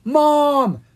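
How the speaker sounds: high, very loud drawn-out cry; shouting. A narrator's reading. Young dragons hearing their parent outside, shouting. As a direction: shout out in surprise. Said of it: "Ella and Owen shouted".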